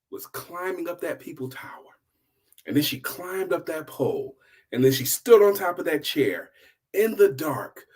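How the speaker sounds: usual speaking voice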